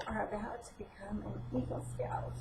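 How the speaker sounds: hushed, voiceless intensity; retold whisper. Mother confronting son before his Eagle ceremony, whispering. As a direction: whisper it like a heartbroken warning.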